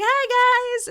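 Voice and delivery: very high, loud chirpy greeting; bright mock greeting; cheery hello. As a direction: wave at the bears. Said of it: "She's like".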